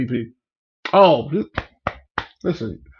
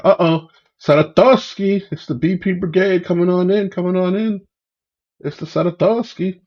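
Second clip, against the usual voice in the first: higher-pitched hype greeting; raised, hyped-up welcome; excited shout-out; 5 semitones higher, 5 dB louder than usual. Real, not acted. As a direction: hail a regular joining the live stream. Hailing a viewer joining the live.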